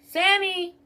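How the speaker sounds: high sing-out name call; imitated calling out. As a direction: call a coworker from another aisle. Boss calls her across the store.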